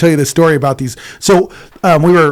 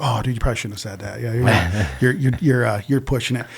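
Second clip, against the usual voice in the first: softer, lower, fast aside; hushed warning; 5 semitones lower, 7 dB quieter, faster than usual. Retold or imitated. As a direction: warn him under your breath. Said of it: "I was like".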